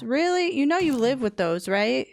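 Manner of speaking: loud, disbelieving telling-off of a pet; exasperated scolding. Real, not acted. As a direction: scold the silly camel, loud. Scolding camel hurt on cactus.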